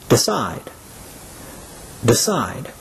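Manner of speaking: decide, weight on the falling "cide"; stress on second syllable. Shown as a demonstration. Teacher models decide for learners, stressing the second syllable. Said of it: "stress is on the second syllable".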